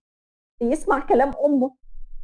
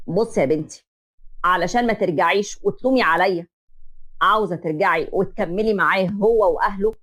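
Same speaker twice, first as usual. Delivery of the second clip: lower, steady father's voice; reassuring father; 5 semitones lower than usual. Acted. Father reassuring his daughter, low and steady. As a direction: promise her you've got her back.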